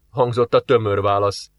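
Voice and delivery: ordinary delivery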